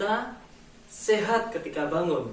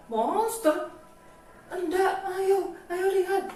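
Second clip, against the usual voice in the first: put-on squeaky little child voice, much higher; 12 semitones higher than usual. Edo telling mama about the monster, high childlike voice. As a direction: play a frightened small child in a high squeak.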